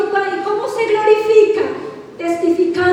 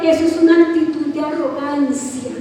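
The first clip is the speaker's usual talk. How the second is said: louder, pressing sermon; fiery preaching; 3 semitones lower, 4 dB louder than usual. Real, not acted. Pastor rousing the congregation, louder and pressing. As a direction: drive it home to the church.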